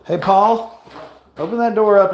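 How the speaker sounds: shouting for a friend to come help; calling out. Real, not acted. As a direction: call out to Paul across the house. Calling to Paul in another room.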